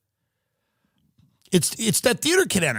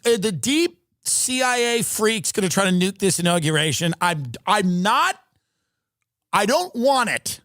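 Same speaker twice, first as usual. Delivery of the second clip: agitated rant; 7 semitones higher than usual. Real, not acted. Ranting about drones at inauguration.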